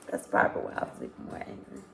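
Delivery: fast, hushed whisper of the same line; whispered aside. Real, not acted. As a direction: breathe it out quickly, like a secret.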